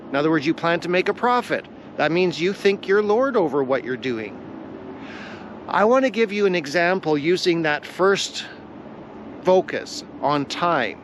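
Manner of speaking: usual speaking voice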